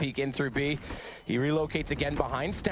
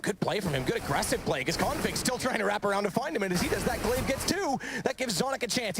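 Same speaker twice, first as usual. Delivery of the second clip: rising excited play-by-play, higher; esports caster; 5 semitones higher than usual. Real, not acted. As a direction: call the clutch as it happens. Live play-by-play of a clutch.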